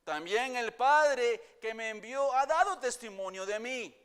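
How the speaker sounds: full-voice sermon reading; forceful preaching. Acted. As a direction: proclaim Jesus' words to the listeners. Preaching Jesus' words at full force.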